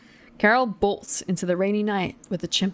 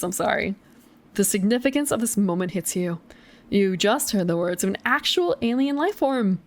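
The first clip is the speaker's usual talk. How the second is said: usual speaking voice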